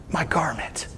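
breathy whisper on "my garment". Retold or imitated. Jesus whispering to the healed woman. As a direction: drop to a whisper.

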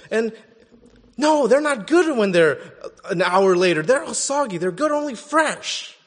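loud, high, fast angry rant at his wife; angry yelling. Retold or imitated. A man in line yelling at his wife, loud and high. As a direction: bark it out like a grumpy husband. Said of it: "yelling at his wife".

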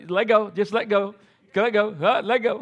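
a nervous dad telling his daughter to let go; urgent "let go". Retold or imitated. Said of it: "and I go".

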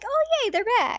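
high, gleeful cheer; excited. Retold or imitated. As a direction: squeal with delight. Excited fan reaction.

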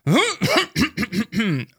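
high, attention-seeking throat-clearing; hammed-up repeated "ahem"; loud fake throat-clearing. A narrator's reading. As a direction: clear the throat theatrically to interrupt. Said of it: "sagde Jama højt".

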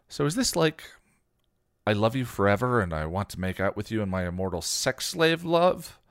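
mock Spike's love with relish; snarky. A narrator's reading. Xander mocks Spike's love to Buffy, snarky. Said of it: "Xander asked snarkily".

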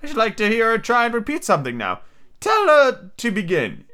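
high-pitched turtle voice; Mock Turtle figure. Mock Turtle asking to hear Alice, high character voice.

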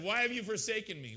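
loud, high cry of protest; yelling at God. Retold or imitated. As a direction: yell it up at the sky in reproach. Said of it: "I was yelling at God".

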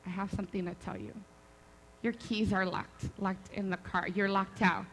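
low, hushed confession; breaking bad news. Sister tells her the keys are locked, hushed low delivery. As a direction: imitate someone gingerly delivering bad news, soft and low.

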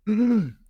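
clearing a sore throat; hard throat clear, voice giving out. Real, not acted. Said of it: "sorry guys, I'm losing my voice".

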